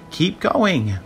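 high little urging voice; tiny shell voice. A narrator's reading. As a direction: urge lightly as a talking shell. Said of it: "the voice replied".

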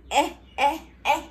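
eh like an elephant, short and loud; short eh sound. Shown as a demonstration. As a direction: punch out eh, eh. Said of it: "just like an elephant goes".

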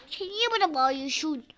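usual speaking voice

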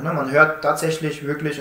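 ordinary talk

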